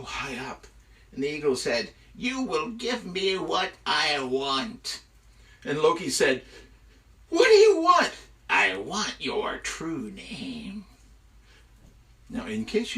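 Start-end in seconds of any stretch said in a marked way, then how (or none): 2.1-5.0 slow, drawn-out demand; commanding eagle. Acted. 7.3-8.1 high, loud, exasperated cry; desperate yell. Acted. Loki yells back at captor. 8.5-10.8 very slow, drawn-out words; ominous demand. Acted. Eagle makes its demand.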